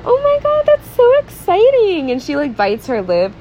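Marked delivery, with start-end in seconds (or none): 0.0-2.1 put-on much higher, gushing girly squeal; high breathless delight over a date; mock giddy. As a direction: ham up a swooning fan girl, slowing on exciting. Mocking Anna's giddy reaction.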